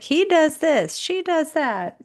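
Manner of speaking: high-pitched tattling imitation; mock complaining. Friend imitating spouses griping in marriage counseling, high mock complaint.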